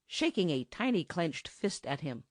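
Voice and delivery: ordinary delivery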